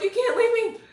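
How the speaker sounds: high, loud cry of "don't leave me"; desperate plea. Retold or imitated. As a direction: cry out like a Western movie deathbed scene.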